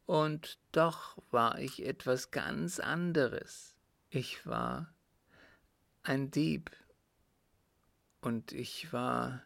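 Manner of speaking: halting confession, breaking off before the worst; anguished dying father. Acted. Father confessing crimes to his son, halting and tormented. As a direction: force each admission out with long pauses.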